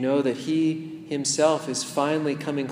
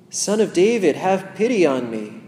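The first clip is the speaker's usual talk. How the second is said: louder, higher desperate cry; pushing through, crying out; 4 semitones higher, 5 dB louder than usual. Pretend. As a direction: cry over the crowd that tries to silence you. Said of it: "pushing through that resistance".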